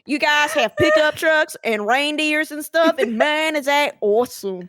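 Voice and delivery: loud, drawling Southern American caricature; hammed-up American. Performing American on request for a classmate, hammed-up American accent. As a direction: gush in an over-the-top American twang. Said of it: "can you speak American too?".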